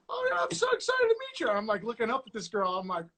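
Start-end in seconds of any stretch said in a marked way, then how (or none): 0.1-1.5 much higher, gushing put-on girly voice; imitating his date. He mimics his Tinder date greeting him in a high eager voice. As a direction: squeal it like an overeager first date. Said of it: "And she was like".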